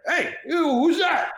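hammed-up De Niro calling out; De Niro impression. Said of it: "Robert De Niro goes by Bobby".

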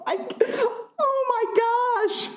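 high, squeaky voice from real laughing; real laughter pushing the voice way up; helpless laughter. Real, not acted. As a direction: gasp it out high, cracking up. Cracking up at an absurd insult.

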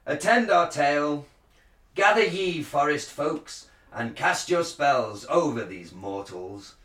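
Shakespearean actor. Shown as a demonstration. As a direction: proclaim it like a druid priest. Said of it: "like a Shakespearean, um, uh, actor".

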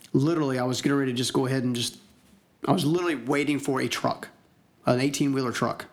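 ordinary delivery

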